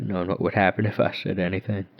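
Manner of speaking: ordinary delivery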